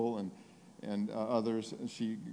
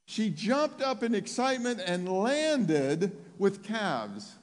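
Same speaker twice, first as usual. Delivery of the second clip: high, impassioned insistence; full-force preaching; 11 semitones higher, 6 dB louder than usual. Real, not acted. Preacher urging congregation to think about atrophied legs, full-force preaching. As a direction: press the point with raised, urgent voice.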